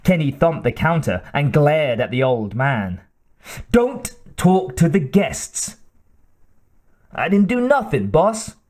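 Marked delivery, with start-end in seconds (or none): 3.7-5.8 higher, slow, annoyed clerk voice; irritated snap. Acted. Clerk snaps at old man. 7.1-8.6 put-on much higher, put-upon old man; whiny protest.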